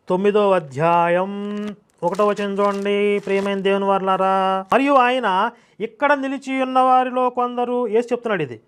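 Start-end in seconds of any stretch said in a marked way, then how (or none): 0.1-4.7 slow, level chant-like announcement; intoned scripture announcement. Acted. Preacher calling the congregation to the chapter and verse, intoned. 4.7-7.9 slow, higher sustained monotone; intoned Bible reading. Acted. Preacher reading Mark's gospel to listeners, solemnly intoned. Reads scripture aloud.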